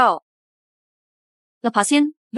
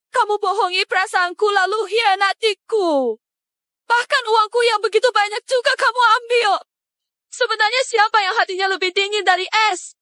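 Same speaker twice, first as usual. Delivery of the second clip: high, furious shouted accusation; wife shouting at her cheating husband; angry accusation; 6 semitones higher than usual. Acted. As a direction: hurl the betrayal back at him.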